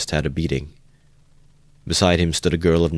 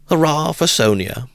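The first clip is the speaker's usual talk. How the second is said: bitter mock cheer; 10 semitones higher, 4 dB louder than usual. A narrator's reading.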